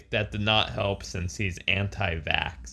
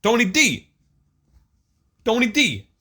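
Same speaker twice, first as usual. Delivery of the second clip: much higher, louder mock announcer call; mock Italian name call; 11 semitones higher, 6 dB louder than usual. Teasing her cohost, calling out Tony D like a hammed-up announcer. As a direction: belt the name like a ring intro.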